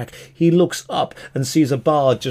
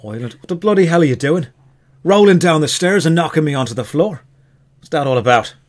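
put-on indignant elf with an Irish accent; 2 semitones higher, 4 dB louder than usual. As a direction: put on an Irish brogue, affronted. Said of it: "the elf is Irish".

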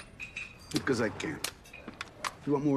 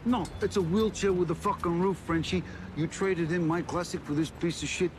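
indignant outburst; 7 semitones higher than usual. Acted. Disgust at replacement car.

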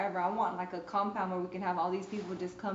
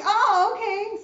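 loud, high-pitched surprised "oh"; retold surprise; 11 semitones higher, 12 dB louder, slower than usual. Beautician reacting to client's answer about the prayer, loudly surprised. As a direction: jump up in pitch, caught off guard.

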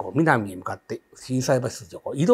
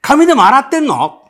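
high, loud call into the room; retold shout to an unseen friend; 12 semitones higher, 9 dB louder than usual. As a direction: yell the question to someone out of sight. Keeps calling to unseen friend.

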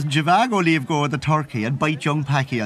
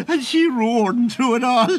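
laughing; 8 semitones higher than usual. Real, not acted. Laughing at his own story.

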